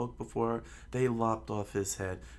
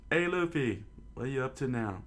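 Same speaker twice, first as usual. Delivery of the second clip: put-on high-pitched call up to the kid on the ship; 4 semitones higher, faster than usual. Villager calls up to Luffy on the ship, high and teasing. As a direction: shout up to him, teasing.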